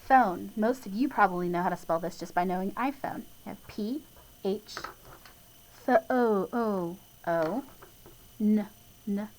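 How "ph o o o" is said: "ph o o o" slowly, holding the long o; sounding out. Shown as a demonstration. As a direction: draw out the o in phone slowly. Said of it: "that long O sound".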